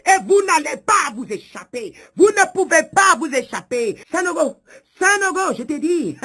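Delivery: high, loud shouted warning; fierce prophetic shouting; wrathful threat. Acted. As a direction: call out the accused with full force. Shouted threat, calling the accused.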